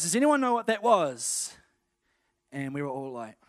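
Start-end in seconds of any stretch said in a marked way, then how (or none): 0.0-1.5 higher, louder question to the room; leader quizzing kids. Retold or imitated. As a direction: call it out to a group of kids.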